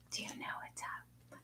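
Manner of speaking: soft breathy whisper of Do you know what's up; secretive hushed repeat; whispering the question to the dog. Shown as a demonstration.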